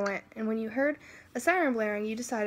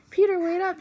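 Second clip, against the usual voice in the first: higher, louder call after him; 7 semitones higher, 6 dB louder than usual. Acted. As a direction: shout to stop him as he runs. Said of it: "you yelled".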